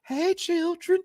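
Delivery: high, drawn-out mocking character voice; put-on high creepy greeting; Missus Herbert. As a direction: croon it way up high. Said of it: "Y'all heard how she was sounding".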